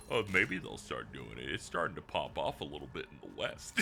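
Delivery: low, soft, laughing friend voice; imitating a friend. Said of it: "I did like your Koba going".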